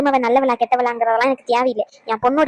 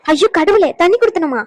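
exasperated cry; 5 semitones higher, 5 dB louder than usual. Acted. Exasperated outburst in quarrel.

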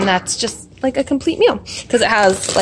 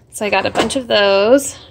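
ordinary talk, nothing marked

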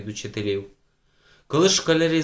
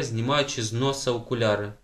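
ordinary delivery, nothing marked

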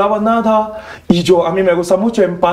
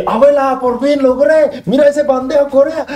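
high, beaming show-and-tell to grandma; proud excited call to grandma; 8 semitones higher than usual. Retold or imitated. Grandson proudly showing his grandma the tray, high and excited.

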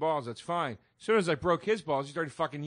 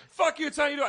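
higher, mock-indignant snap; mock angry retort; 9 semitones higher, much faster than usual. Mocking a co-host's angry comeback. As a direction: parody his angry comeback, pitched up. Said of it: "And you go".

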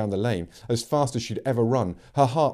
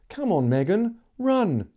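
urgent plea; 9 semitones higher, slower than usual. Acted. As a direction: hurry her along, pitch climbing on "run".

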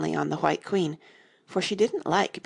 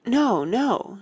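higher, louder, flustered retraction; hasty self-correction; 7 semitones higher, 5 dB louder than usual. A narrator's reading. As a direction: take it back at once. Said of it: "she hastily corrected herself".

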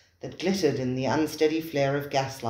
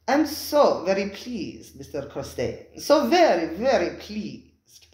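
high, slow purring fawning voice; purring host; 5 semitones higher, 3 dB louder, slower than usual. Acted. Spiro purring his greeting to Costain, slow and high. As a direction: purr the welcome slowly. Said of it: "purred the voice".

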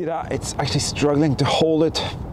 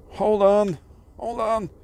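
urgent hold on; 9 semitones higher, 4 dB louder than usual. Real, not acted. ROV grabs the plate, urgent.

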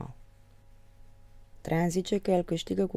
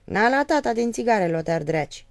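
put-on a little higher and louder, teasing the child; coaxing father; 6 semitones higher, 6 dB louder than usual. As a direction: offer the cigarette playfully, raised. (tată care-și tachinează copilul)

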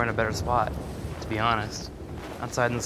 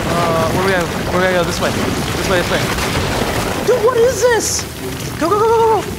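being chased; 13 semitones higher, 9 dB louder than usual. Real, not acted. Frantic fleeing from monsters in game.

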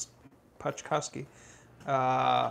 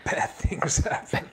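library whisper; slower than usual. Pretend. Dramatic answer about cold chicken.

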